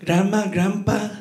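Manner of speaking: put-on little kid with an Indian accent; childhood Indian accent. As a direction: ask politely in a sing-song Indian accent. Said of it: "I had an Indian accent when I was growing up".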